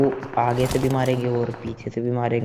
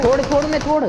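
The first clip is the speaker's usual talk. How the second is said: shouting; 13 semitones higher, 4 dB louder than usual. Real, not acted. Urgent callout in gunfight.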